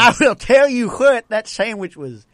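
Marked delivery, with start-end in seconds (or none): 0.0-1.3 put-on country accent. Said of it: "Country, country guy".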